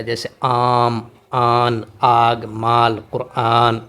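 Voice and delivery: آم, آن, آگ, مال, قرآن with the long aa vowel stretched; drawn-out alif madd. Shown as a demonstration. Teacher stretching the madd vowel for students, drawn out. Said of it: "اسے کھینچ کر پڑھا جاتا ہے".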